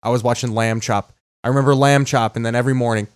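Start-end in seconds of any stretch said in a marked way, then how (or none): none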